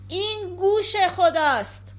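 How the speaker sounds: high-pitched proclamation; loud cry. A narrator's reading. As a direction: call it out aloud to the sky.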